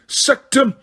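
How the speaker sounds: lunge in with the curse; shouted curse. A narrator's reading. Harry staggering toward Snape, shouted curse. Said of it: "Harry attacking Snape".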